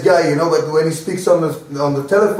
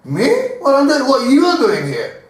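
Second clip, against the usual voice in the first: indignant retort; 8 semitones higher than usual. Retold or imitated. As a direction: throw the question right back at him. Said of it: "If you will speak like this".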